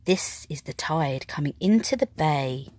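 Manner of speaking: usual speaking voice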